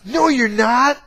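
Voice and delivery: a younger brother throwing the apology back; indignant kid retort. Retold or imitated.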